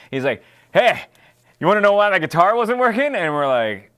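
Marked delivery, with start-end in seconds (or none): 0.7-3.2 gleeful taunt. Retold or imitated. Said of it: "He's laughing".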